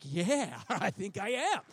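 loud, high laughing yes; delighted agreement. Retold or imitated. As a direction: blurt it out with an amazed laugh. Acted delighted reply to Rosa.

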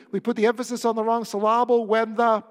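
loud, with "syllable" as sil-LAH-ble; emphatic joke mispronunciation, "syllable" stressed on LAH; wrong-syllable stress. Shown as a demonstration. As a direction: project it, stressing the middle of "syllable".

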